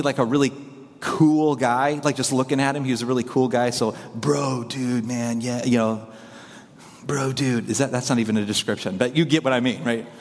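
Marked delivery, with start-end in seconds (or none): 4.1-7.7 low, laid-back Valley dude voice. Retold or imitated. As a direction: imitate a cool Valley bro. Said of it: "valley voice".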